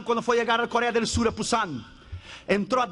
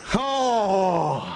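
anguished cry; 4 semitones lower, 3 dB louder than usual. Retold or imitated. Anguished cry mid-story.

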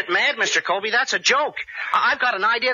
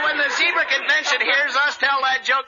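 normal talking voice

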